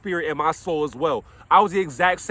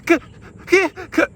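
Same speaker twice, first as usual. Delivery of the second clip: mock child stutter, higher; a light imitation of a child stuck on a sound; 11 semitones higher, 5 dB louder than usual. Imitating a stuttering child for the listener. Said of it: "they may stutter".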